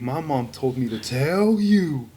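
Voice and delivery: slow, put-on comic voice; Kevin Hart. Kid cussing at the teacher, drawn-out comic voice. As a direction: drag it out as a skit line. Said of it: "it's like the Kevin Hart skit".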